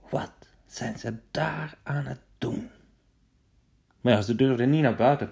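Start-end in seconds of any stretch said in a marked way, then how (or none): 0.1-2.7 whisper. Acted. Trojans wonder about noise.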